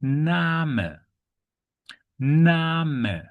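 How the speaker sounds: "Name" as German NAH-muh; German pronunciation. Shown as a demonstration. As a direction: say "Name" with a long AH and a sounded final vowel. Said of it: "the correct pronunciation is".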